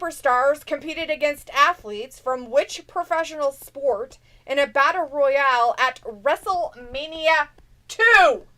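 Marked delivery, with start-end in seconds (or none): 2.2-8.5 dramatic trivia build. Acted. Building trivia question reveal.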